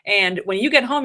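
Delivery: normal talking voice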